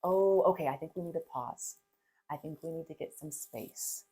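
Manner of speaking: put-on hushed soothing mom to an upset kid; calm reassuring parent. Shown as a demonstration. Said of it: "the language is".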